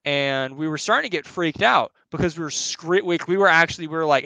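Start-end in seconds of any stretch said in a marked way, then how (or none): none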